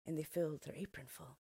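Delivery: plain reading voice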